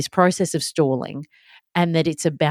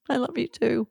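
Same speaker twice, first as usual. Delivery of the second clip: soft, high, guilty reply; acted small, apologetic voice; sheepish tenderness; 8 semitones higher, 6 dB quieter than usual. Guilty mum answering her daughter's love, sheepishly tender.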